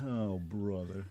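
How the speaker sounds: ordinary talk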